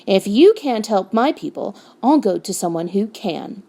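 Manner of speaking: plain reading voice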